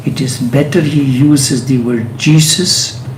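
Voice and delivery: ordinary delivery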